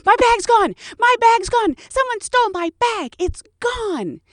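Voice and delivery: high frantic wail about a lost bag; panic, voice shooting up high and loud; panicked passenger. Retold or imitated. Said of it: "red in the face".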